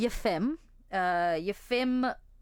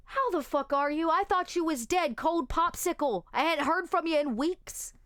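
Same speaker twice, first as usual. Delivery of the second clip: higher, rowdy greeting; Bryce's voice; 8 semitones higher than usual. Acted. Bryce to the returning friend, higher and rowdy. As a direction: hail an old friend, higher and rough. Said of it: "And Bryce just says".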